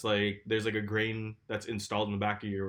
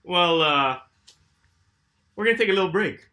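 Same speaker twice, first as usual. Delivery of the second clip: announcer voice; 6 semitones higher, 9 dB louder than usual. Acted. Announcing a fake show break.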